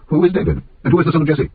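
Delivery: high-pitched dismissive questions; scornful challenge. A narrator's reading. Nabal answering David's servants, scornful challenge. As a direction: toss the questions out high, with contempt.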